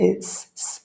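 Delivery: a long unvoiced hiss, sss. Shown as a demonstration. Teacher isolating the hiss sound of sin, soft. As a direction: let the air hiss through the teeth. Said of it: "এই আওয়াজটা যদি না বের হয়".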